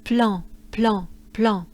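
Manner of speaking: plan with a nasal French an; nasal plan drill. Shown as a demonstration.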